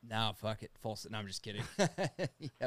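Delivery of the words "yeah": laughing yeah. Real, not acted. Laughs while answering.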